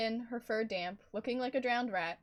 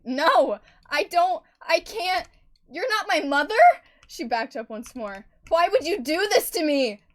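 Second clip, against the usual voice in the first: loud, much higher broken cry; distraught screaming denial; anguished shout; 8 semitones higher, 15 dB louder than usual. Acted. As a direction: scream it in shock. Daughter learns the truth.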